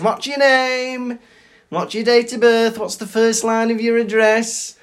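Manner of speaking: high-pitched sing-out of routine questions; receptionist voice. Retold or imitated. Receptionist checking in a patient at A and E, high-pitched mimicry.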